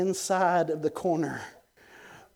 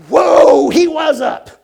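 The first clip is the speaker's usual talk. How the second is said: loud, high whoop of amazement; explosive preacher's exclamation; astonished shout; 11 semitones higher, 19 dB louder, slower than usual. Retold or imitated. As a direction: burst out shouting in excitement. Exclaims at old man leaping up.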